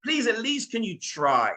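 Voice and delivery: high, impassioned pleading; boy's loud, desperate appeal to the king; insistent protest. Acted. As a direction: refuse to give up, voice climbing high. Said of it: "But Brett didn't give up".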